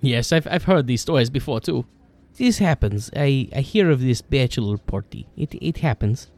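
put-on heavy accent with broken English; foreign accent. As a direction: play the blunt foreigner with a thick accent. GM voices Broden in broken English.

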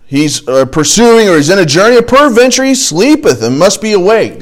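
big sarcastic jeer; sarcastic mocking. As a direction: call it out loudly, mocking their god. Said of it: "he's a little bit sarcastic here".

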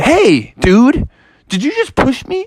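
rising, very high offended frog; high indignant frog. Acted. Froggy accusing Toadstool after the bump, high and indignant. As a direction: climb up high in disbelief.

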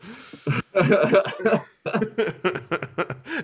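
long high laugh. Real, not acted. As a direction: laugh out loud at your own joke. Laughter after joke.

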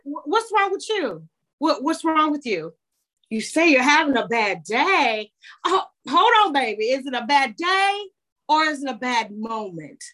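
grandmotherly voice. Acted. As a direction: lift the pitch and fuss over them like a loving grandmother. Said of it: "I honestly have this voice that comes out".

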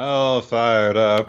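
slow, drawn-out mock hero voice; teasing echo of the battle cry; echoed fired-up. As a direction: savour the catchphrase as you repeat it. Co-host echoes the quote.

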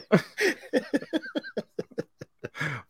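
long high giggling laugh. Real, not acted.

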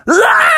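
high drawn-out mock scream; cartoon yell of shock; long scream. Officer gets a fly up the nose, screaming.

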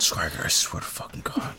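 harsh exasperated whisper. Real, not acted. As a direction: hiss it under your breath. Exasperated aside at co-host.

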